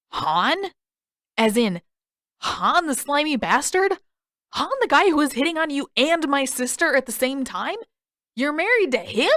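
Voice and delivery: put-on a touch of an outraged teenage boy; Sokka, incredulous. A narrator's reading.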